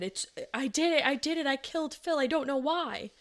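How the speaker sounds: high-pitched imitation of a distraught wail; retold hysterical confession. Woman confessing at the bedroom doorway, imitated hysterical cry. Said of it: "Bryn started screaming and sobbing".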